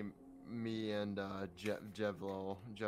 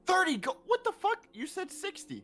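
very high, loud squawk of disbelief; outraged protest; 7 dB louder than usual. Acted. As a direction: shriek at being short-changed. Player outraged at short payment.

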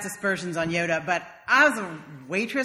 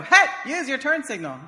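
mock yell out the window, higher and louder; yelling out the car window; 7 semitones higher, 5 dB louder than usual. Driver yelling at another car, a mock shout. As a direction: call it out half-laughing at the other driver. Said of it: "rolling down the window and go".

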